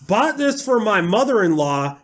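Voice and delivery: high, loud Southern reviewer voice; Southern accent. Acted. Mocking a pointless Amazon review in a high, loud Southern accent. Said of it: "I'm using a Southern accent".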